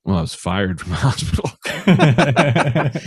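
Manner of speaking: breaking into laughter on the last word; laughing admission. Real, not acted. Comedian admitting being fired from the hospital, laughing. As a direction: let a real laugh take over as you finish.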